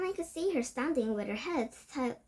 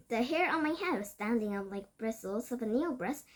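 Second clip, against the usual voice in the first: usual speaking voice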